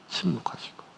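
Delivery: softly whispered. Acted. Preacher telling of God's silence, whispering. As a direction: breathe the word like a hush.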